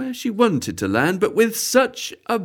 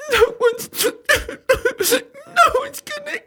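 much higher, sobbing and slow; slower than usual. Acted. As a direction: blubber it out between sobs. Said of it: "shaking and sobbing there".

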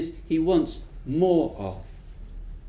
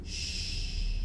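soft unvoiced hush; shh; 11 dB quieter than usual. Little creature hushing the witch, soft. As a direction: hush her gently. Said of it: "puts her finger to his lips".

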